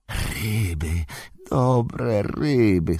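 slow hissed creature voice, words stretched out; Golum hissing. A narrator's reading. Golum absorbed in eating his fish, slow hissing. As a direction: hiss it to yourself, savouring each word. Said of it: "syczał Golum".

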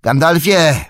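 shout. Acted. Pippin shouts to stop Gandalf riding off, full shout. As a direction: yell the name a second time, desperate to be heard. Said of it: "krzyknął Pippin".

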